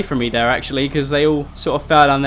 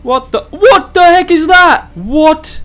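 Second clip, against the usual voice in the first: loud, high, drawn-out disbelief; shocked yell; 11 semitones higher, 9 dB louder, slower than usual. Real, not acted. Player spots a strange new monster, shocked yell. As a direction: yell it out, stunned.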